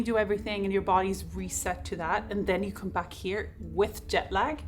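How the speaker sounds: usual speaking voice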